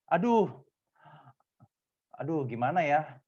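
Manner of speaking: mock hesitant reply, with a long pause; ragu-ragu. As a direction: stall over the answer, lightly acted. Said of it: "Kenapa kamu ragu-ragu?". (pura-pura ragu menjawab)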